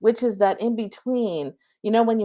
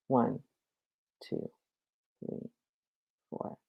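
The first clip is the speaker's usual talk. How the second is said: guided breathing count. Acted. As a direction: guide the listeners through each breath calmly. Leads a breathing meditation.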